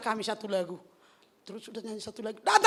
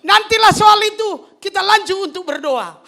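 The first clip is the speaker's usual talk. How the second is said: loud brush-off; 6 semitones higher, 13 dB louder than usual. Retold or imitated. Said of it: "ku bilang kayak gini".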